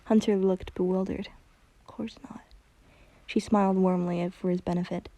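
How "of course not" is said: whispered, much softer; hushed. A narrator's reading. Bewildered Hunter answering Luz, hushed whisper. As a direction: breathe it out barely voiced.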